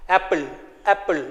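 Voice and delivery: apple with a short open a, said softly. Shown as a demonstration. Teacher modelling apple for pupils, soft and clear. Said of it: "In the short sound".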